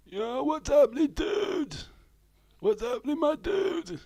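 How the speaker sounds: very high, loud mock American dude; American dude accent. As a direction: squeal it like a cartoon American dude.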